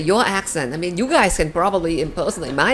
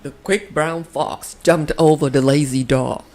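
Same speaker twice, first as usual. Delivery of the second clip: imitating an American accent; light American accent impression; 2 semitones lower than usual. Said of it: "[name] impersonates Sean's American accent".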